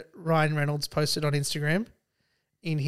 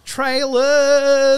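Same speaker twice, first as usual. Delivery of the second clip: excited; 8 semitones higher, 8 dB louder than usual. Real, not acted. Excited announcement of trailers segment.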